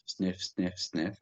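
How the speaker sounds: three short sniffs through the nose; quick sharp nose sniffs; sniffing the air. Shown as a demonstration. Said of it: "three in through the nose".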